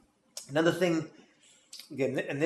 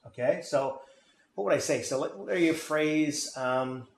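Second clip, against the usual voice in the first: ordinary talk, nothing marked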